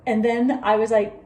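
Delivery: ordinary delivery, nothing marked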